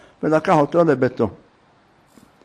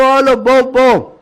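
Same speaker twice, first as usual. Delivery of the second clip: higher, louder shouted summons; acted insistent hailing; calling out; 6 semitones higher, 4 dB louder than usual. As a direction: shout to someone passing far away. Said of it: "זה צעק לו".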